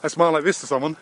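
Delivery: usual speaking voice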